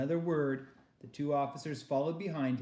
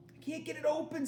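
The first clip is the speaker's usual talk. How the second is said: put-on frightened girl; 14 semitones higher than usual.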